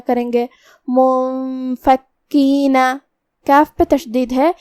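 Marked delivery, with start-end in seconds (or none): 0.9-2.9 very slow recitation of منفکینہ with the noon hidden in a long nasal hum. Shown as a demonstration. Teacher demonstrates ikhfa to student, nasal hum. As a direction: stretch منفکینہ, noon hummed through the nose. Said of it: "غنہ کے ساتھ یہ حکم کو ادا".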